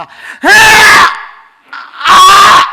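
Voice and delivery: acted scream of a mother pushing; high, loud birthing cry; straining labour scream. Mother pushing in childbirth, loud mock scream. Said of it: "힘 주셨겠니?".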